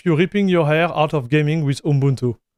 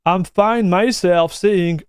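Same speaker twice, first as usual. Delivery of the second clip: usual speaking voice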